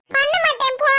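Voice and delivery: put-on high, bratty little girl's refusal; a sulky little girl who won't do homework; defiant child. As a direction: fling the no like a sulky kid.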